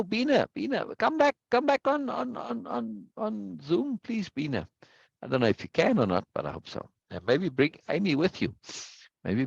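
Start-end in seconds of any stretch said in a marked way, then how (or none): 0.0-4.7 high sing-song call to come back. Real, not acted. Teacher calling a dropped student back onto Zoom, high and coaxing. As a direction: coax her back on the call in a much higher voice.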